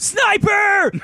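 high, loud warning yell; sudden piercing alarm call; alarmed shout. Acted. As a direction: scream it out to the whole room. Sudden alarm at laser dot in scene.

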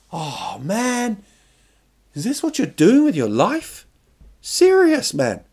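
high, incredulous whine; mock dismay. Kids looking at the man in the banana costume, mock dismay. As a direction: imitate an appalled youngster, voice swooping high.

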